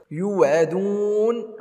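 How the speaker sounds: slow, chanted tajweed recitation; measured tarteel chant; Quran recitation. Shown as a demonstration. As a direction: recite it melodically, drawing out the madd. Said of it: "هنا كذلك مد عارض".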